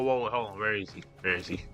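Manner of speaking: usual speaking voice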